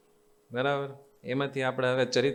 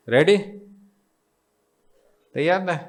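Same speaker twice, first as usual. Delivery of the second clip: eager call; 3 semitones higher, 5 dB louder than usual. Real, not acted. Rallying the audience before starting.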